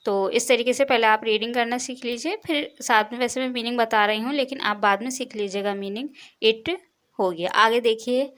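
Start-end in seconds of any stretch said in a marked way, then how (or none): none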